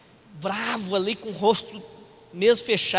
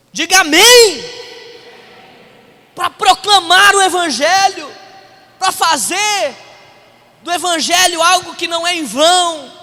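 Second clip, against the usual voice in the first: full-force preaching; 5 semitones higher, 8 dB louder than usual. Real, not acted. Pastor calling the church to proclaim, full-force preaching. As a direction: proclaim it loudly to the whole church.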